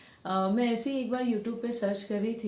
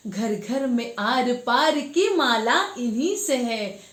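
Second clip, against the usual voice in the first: declaimed verse, swelling on each repeated line; impassioned poetry recitation; 3 semitones higher, 6 dB louder, slower than usual. Acted. Woman performing a poem on Ganga's suffering, impassioned declaiming. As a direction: recite with rising force and lilting emphasis.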